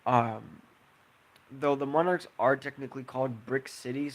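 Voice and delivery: usual speaking voice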